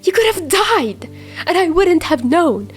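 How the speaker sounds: screaming. A narrator's reading. Chuya screaming at Dazai in the rain, tearful. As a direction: let it break out of him. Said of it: "Chuya screamed".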